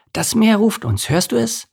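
high, thrilled question; eager wonder, pitched right up; excited. A narrator's reading. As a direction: urge your friend to listen. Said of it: "Aufgeregt schwamm Lars".

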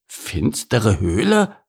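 slow, drawn-out indignant question; indignant echo. Acted. Beaver hears the bird's accusation, indignant. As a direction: repeat it slowly in disbelief. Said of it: "rief Bert entrüstet".

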